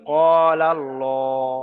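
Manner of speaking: ক্বালাল্লাহ slowly, with long "qo" and full "llo"; spelling-out demonstration. Shown as a demonstration. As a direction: draw out ক্বালাল্লাহ, L heavy and rounded. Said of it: "উচ্চারণ করে দেখিয়ে দিচ্ছি".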